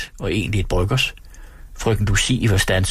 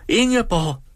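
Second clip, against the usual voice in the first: "Ingeborg!" called again much higher, falling at the end; lady in the carriage calling her friend a second time, higher; calling louder; 11 semitones higher than usual. A narrator's reading. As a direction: call "Ingeborg!" again, more insistently. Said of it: "råbte den ene af damerne".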